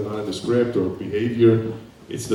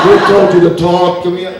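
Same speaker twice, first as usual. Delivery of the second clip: high, loud, furious yell at her; furious shout; 8 semitones higher, 12 dB louder than usual. Acted. Actor in audition rounds on his scene partner, shouting in fury. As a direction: tear into her as if you'd slap her.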